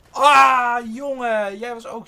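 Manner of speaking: high, very loud yelled "ah"; exasperated shout. Real, not acted. Gamer outraged at a weak opponent, exasperated shout. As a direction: yell out at the opponent.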